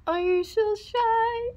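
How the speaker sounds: put-on high, slow, laughing baby-talk coo; mocking coo. Cooing at the embarrassed pup, high and mocking.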